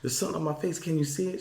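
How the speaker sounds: high, insistent reenactment; urgent retold alert. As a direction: urgently get your wife to look, mock-panicked. Waking wife in alarm, retold.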